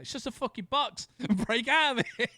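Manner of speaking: high, loud mocking yell with laughter; exasperated high voice. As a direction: snap at her to just get out. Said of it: "It's like".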